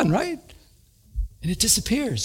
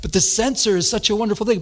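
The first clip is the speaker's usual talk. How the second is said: normal talking voice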